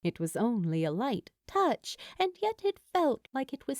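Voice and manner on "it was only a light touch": usual speaking voice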